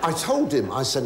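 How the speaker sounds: ordinary talk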